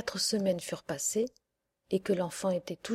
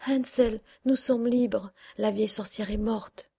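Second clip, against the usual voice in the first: joyful news; 2 semitones higher, 4 dB louder than usual. Acted. Gretel freeing her brother from the stable, joyful cry. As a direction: burst out with the good news. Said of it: "et dit".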